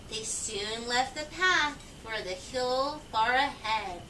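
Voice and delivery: usual speaking voice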